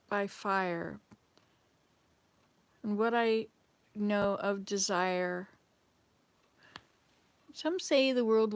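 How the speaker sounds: slow, measured poem reading; poem recital. Acted. Reciting a favourite Robert Frost poem to viewers, slow and measured. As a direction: recite it slowly, savouring each line. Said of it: "Ice and Fire".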